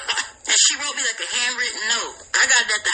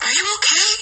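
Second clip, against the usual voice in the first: alarmed concern; 8 semitones higher, 4 dB louder than usual. Retold or imitated. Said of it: "she's like".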